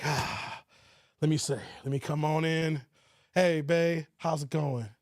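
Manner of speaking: low, tired homecoming husband; T Orlando. Acted. Husband greeting his wife after work, low and worn-out. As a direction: impersonate a weary lawyer sighing in from work. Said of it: "Here's my impersonation of T Orlando coming home".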